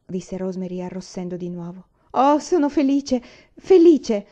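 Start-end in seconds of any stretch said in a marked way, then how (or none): 2.2-4.3 higher and louder, rising to a cry of joy; a child overflowing with relief; joyful burst. Acted. Una tells Miss West her joy, exclaiming. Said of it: "disse coraggiosamente Una".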